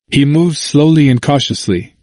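usual speaking voice

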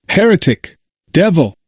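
high, restrained cry of accusation; crying out accusingly. A narrator's reading. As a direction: hurl each word high, at ordinary volume. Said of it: "cried they".

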